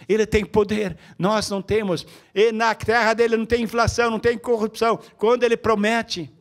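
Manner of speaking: loud, high preacher's call to the church; fiery preaching. Acted. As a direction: drive each phrase home from the pulpit. Preaching at full force.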